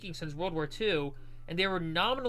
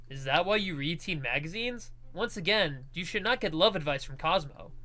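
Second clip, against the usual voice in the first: plain reading voice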